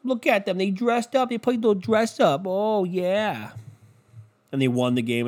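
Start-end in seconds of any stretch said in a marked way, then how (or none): 0.0-3.5 high, gushing anchor voice; mocking SportsCenter host. Mocking SportsCenter gushing over Texans costumes, high and gushing. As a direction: gush like a giddy TV sports anchor. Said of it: "SportsCenter was like".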